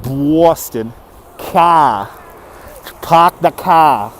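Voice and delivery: dragged-out Boston accent; Boston accent, stretching the vowels slowly. Shown as a demonstration. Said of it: "you kinda drag out certain letters".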